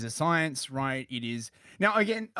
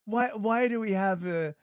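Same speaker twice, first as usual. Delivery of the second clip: a lightly voiced curious child, pitched higher; 7 semitones higher than usual. Retelling asking his dad as child.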